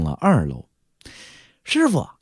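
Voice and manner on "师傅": put-on high-pitched young disciple questioning; doubtful, slightly gloating. Young cultivator questions his master about the newcomer, doubtful and slightly gloating. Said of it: "口气中有质疑，还有点幸灾乐祸".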